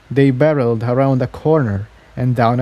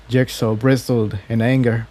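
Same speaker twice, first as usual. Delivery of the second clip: plain reading voice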